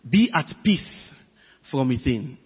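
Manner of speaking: hushed, unhurried reassurance; calm. Real, not acted. Preacher urges listeners to inner peace, calm and soft. Repeats the point calmly.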